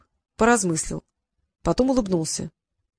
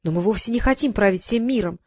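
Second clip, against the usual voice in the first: ordinary delivery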